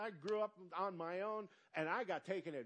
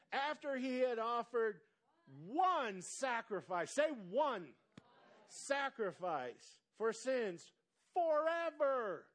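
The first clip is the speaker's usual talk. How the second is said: slow, rising louder and higher to forever; slow building proclamation; 6 semitones higher, 5 dB louder, slower than usual. Shown as a demonstration. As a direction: build the verse up to a ringing forever. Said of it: "I'm gonna read this real slow".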